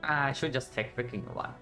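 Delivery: ordinary talk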